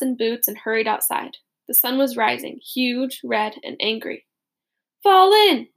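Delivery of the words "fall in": loud, high barked command; officer shouting fall in; shouted order. Acted. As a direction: yell it at the troops. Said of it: "shouted an officer".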